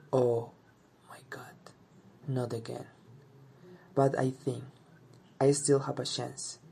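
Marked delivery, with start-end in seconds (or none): none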